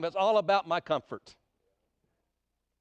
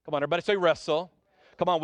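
ordinary talk

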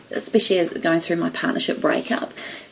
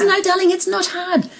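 laughing mentor quote; 9 semitones higher, 4 dB louder than usual. Retold or imitated. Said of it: "And he goes".

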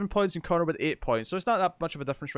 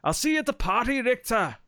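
put-on higher Austrian accent, punchy; attempted Austrian accent, higher; Arnold Schwarzenegger impression; 7 semitones higher than usual. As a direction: deliver it like an Austrian action hero. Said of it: "are you doing the accent?".

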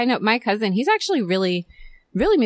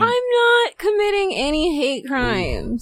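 high, slow, drawn-out denial; indignant protest; 8 semitones higher, slower than usual. Real, not acted. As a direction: deny it indignantly, stretching it out. Protesting the hate crime teasing.

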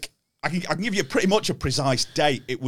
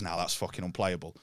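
low, much softer grumble, lower; muttered complaint; 10 semitones lower, 11 dB quieter than usual. Retold or imitated. Comic retells Barry walking offstage, muttering low and soft. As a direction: mutter it under your breath. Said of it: "Barry walks off and goes".

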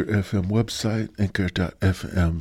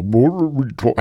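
tongue-tied stumble; 7 semitones higher, 8 dB louder than usual. Real, not acted. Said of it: "I sound like Joe Biden".

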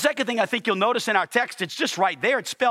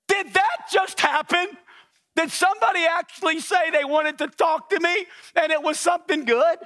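high, raised incredulous questioning; astonished disbelief; 10 semitones higher than usual. Acted. Pastor amazed somebody said something good, astonished disbelief. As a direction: play up the amazement. Amazed after unexpected praise.

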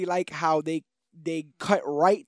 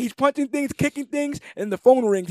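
ordinary talk, nothing marked

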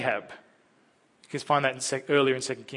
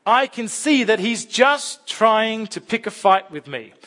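steady, louder Bible reading to the congregation; scripture reading; 6 semitones higher, 7 dB louder than usual. Acted. Preacher reading the Naaman story aloud to the church, a clear, louder read. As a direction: read the passage out with weight.

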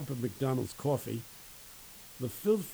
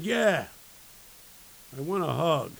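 eager homeless man; 3 semitones higher, 6 dB louder than usual. Retold or imitated. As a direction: blurt it out loud and eager. Homeless man's acted eager reply.

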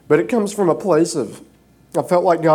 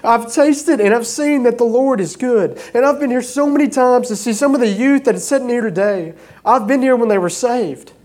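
higher, louder preaching that builds with each testimony; preaching at full force; 7 semitones higher, 3 dB louder than usual. Acted. Pastor testifying to his congregation about healings, preaching at full force. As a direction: lift the room with every answered prayer.

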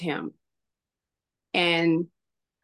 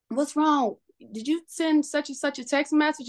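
imitating a mother's higher-pitched questioning; retold query, pitched up from usual voice; concerned questioning; 7 semitones higher than usual. Mother questions son about the text message, concerned questioning.

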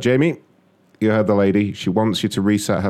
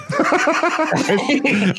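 squealing high laugh; high cackle. Real, not acted. High laughter at his own soundboard gag.

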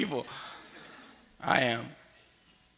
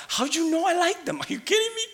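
squealing far higher, giddy lover. Retold or imitated. Preacher imitating new lovers gushing over each other, much higher. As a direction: gush like someone newly in love.

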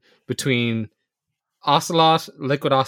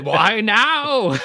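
higher-pitched, hammed-up outburst at an arm; mock yell; 6 semitones higher than usual. Co-host acting a villain whose arm acts up, mock yell. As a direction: cry out in mock anguish, drawn out. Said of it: "yelling at his arm".

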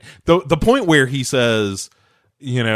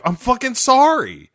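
very high-pitched retold outburst; mock exasperated apology; 12 semitones higher than usual.

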